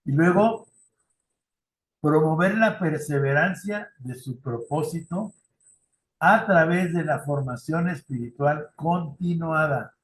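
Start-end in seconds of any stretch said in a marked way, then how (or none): none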